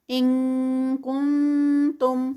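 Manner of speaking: ان کنتم with a nasal ink sound, slow; light ikhfa. Shown as a demonstration. Teacher reciting to show light ikhfa, slow. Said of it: "انک، آئی این کے کی sound آئے گی".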